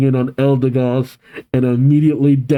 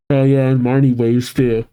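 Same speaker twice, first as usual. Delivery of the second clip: ordinary delivery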